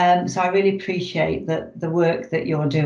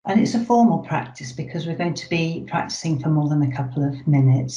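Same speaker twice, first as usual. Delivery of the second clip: usual speaking voice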